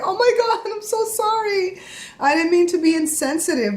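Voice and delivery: high-pitched pleading apology, re-enacted; retold apology. Mother retelling her apology to bawling Jada, high and pleading. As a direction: replay a flustered sorry, voice jumping up high.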